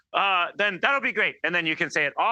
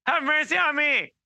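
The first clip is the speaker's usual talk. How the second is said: raised, pleading call; crying out; 10 semitones higher than usual. Retold or imitated. Beggar ignores the crowd and calls to Jesus, pleading cry. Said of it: "he was like".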